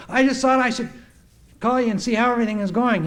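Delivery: friendly check-in call, lightly acted; lightly voiced phone caller. Him phoning somebody in New York, lightly acted chatty caller. Acts out his phone calls.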